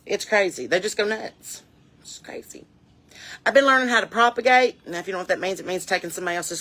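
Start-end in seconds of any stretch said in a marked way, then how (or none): 2.0-2.7 hushed, voiceless aside; whisper. Real, not acted. Marvelling to listeners about the chickens, whispered. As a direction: drop to a whisper in disbelief. Hushed repeat of own remark.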